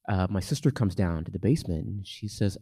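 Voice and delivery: ordinary delivery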